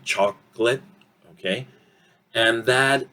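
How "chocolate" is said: CHOCOLATE as CHOCK-lit; no middle O in CHOCOLATE; two-syllable CHOCOLATE; reduced vowel. Shown as a demonstration. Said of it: "O reducing or disappearing".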